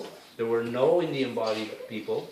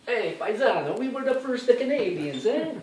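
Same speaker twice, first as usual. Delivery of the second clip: mock Italian accent, pitched much higher and a little louder; playful higher-pitched Italian voice claiming to be first; 11 semitones higher, 4 dB louder than usual. Italians boasting they were first Canadians, in a mock Italian accent. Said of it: "the Italians say".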